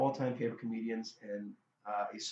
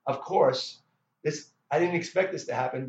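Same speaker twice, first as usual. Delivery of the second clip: normal talking voice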